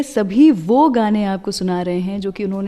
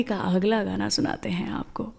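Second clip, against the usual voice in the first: teasing radio whisper before the song; hushed aside to listeners; 2 semitones lower, 8 dB quieter than usual. Real, not acted. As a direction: lean in and whisper the tease. Radio host teasing next song.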